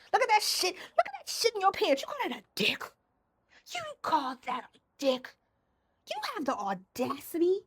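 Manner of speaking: high sneering domme taunts; mean domme. Acted. A domme mocking a sub, high and sneering. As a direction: belittle him with a high sneer. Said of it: "And I'd be like".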